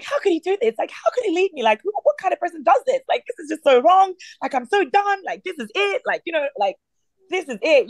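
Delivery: high-pitched indignant lament; upset. Retold or imitated. Wife left behind by husband, re-enacted upset outburst. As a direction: re-enact a wronged wife's high, exasperated venting. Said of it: "I was so upset".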